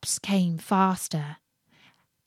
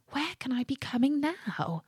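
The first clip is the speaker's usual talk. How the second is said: quiet wondering; 5 semitones higher, 8 dB quieter than usual. Acted. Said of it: "he wondered".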